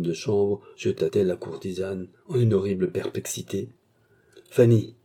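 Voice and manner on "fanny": loud, commanding summons; imperious call. A narrator's reading. Mistress summoning her maid, imperious. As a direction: summon the servant sharply. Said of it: "dit-elle impérieusement".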